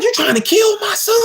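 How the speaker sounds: high, shocked shout at the auntie; mom shouting. Retold or imitated. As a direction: yell it, stepping in to protect her son. Mom confronts auntie protecting him.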